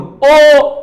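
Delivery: ओ loud and long, lips rounded, voice from the throat; round-mouthed ओ. Shown as a demonstration. Teacher demonstrates ओ to the class, loud. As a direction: shape ओ with round lips, full voice. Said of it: "अब मुंह भी गोल".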